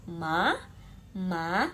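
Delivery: MA swept up like a shocked question; "ma" rising as if surprised; rising second tone. Shown as a demonstration. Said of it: "Nada dua".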